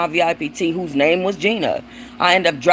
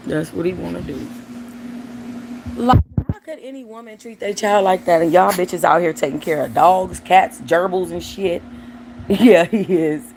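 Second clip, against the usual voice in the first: ordinary delivery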